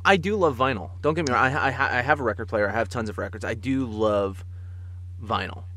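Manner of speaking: normal talking voice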